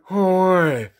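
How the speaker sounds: one loud open-mouthed syllable; mouth-guard garble. Retold or imitated. Patient testing the dentist, mouth-guard garble. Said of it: "huge mouth guard in their mouth".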